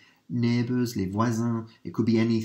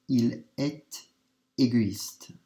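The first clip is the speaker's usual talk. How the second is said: usual speaking voice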